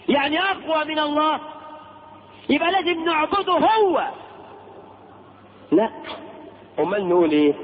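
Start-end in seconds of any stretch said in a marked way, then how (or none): 0.1-4.1 sarcastic. Real, not acted. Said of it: "preacher's sarcastic reasoning".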